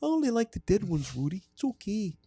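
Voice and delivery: put-on high-pitched Wisconsin hockey accent; high, sing-song Midwestern creep. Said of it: "I got locked into characters".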